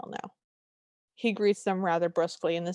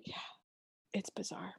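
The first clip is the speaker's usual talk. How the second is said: breathless whisper, hardly voiced; 15 dB quieter than usual. Real, not acted. Co-host whispering an aside about the odd scene. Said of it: "whispered aside".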